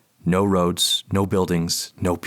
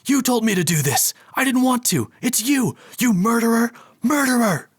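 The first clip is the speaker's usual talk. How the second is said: much higher furious scream; 3 dB louder than usual. Acted. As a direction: scream the accusation. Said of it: "I'm screaming now".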